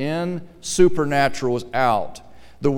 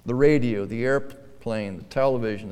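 usual speaking voice

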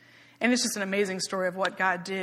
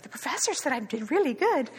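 higher, swooping, pleased-as-punch boast; imitating proud lady; 5 semitones higher than usual. Older lady boasting to coworkers about praise, imitated proudly. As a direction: imitate a delighted older student bragging. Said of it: "and she'd be like".

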